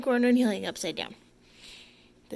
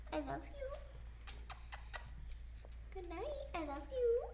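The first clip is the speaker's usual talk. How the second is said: high, soft tender goodnight; pillow talk; 9 semitones higher, 12 dB quieter than usual. Real, not acted. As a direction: whisper good night sweetly. Wife's bedtime to bird.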